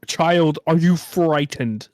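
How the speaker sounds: loud, slow, portentous question; mock-solemn interrogator. Store worker to a scared child, mock-solemn booming voice. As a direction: intone it like a grand ominous being.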